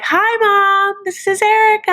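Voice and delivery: high singsong phone greeting, stretched out; mock-cheery daughter. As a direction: imitate an overly sweet hello to mom. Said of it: "she's like".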